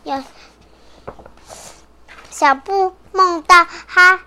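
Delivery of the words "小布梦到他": ordinary delivery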